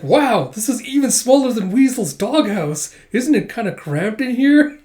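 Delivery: high, loud, incredulous wonder; hammed-up astonished rich kid; tactless amazement. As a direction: marvel bluntly at how tiny it is. Rich child astonished by tiny room.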